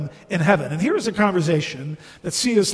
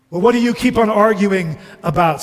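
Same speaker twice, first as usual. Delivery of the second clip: fast, louder retort; indignant ghost; 4 semitones higher, 7 dB louder, faster than usual. Acted. Ghost challenging the bright man's rebuke, indignant. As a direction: argue back, affronted.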